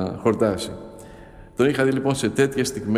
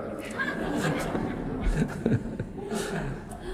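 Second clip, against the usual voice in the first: breathy laugh after the punchline; laughter; 6 semitones higher, 8 dB quieter than usual. Real, not acted. Monk laughs at the elder's riddle punchline, amused laughter.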